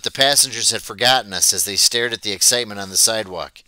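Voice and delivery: plain reading voice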